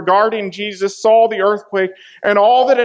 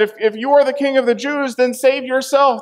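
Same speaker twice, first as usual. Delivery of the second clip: higher, sneering challenge to the king; mocking taunt; 6 semitones higher than usual. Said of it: "they mocked him even more".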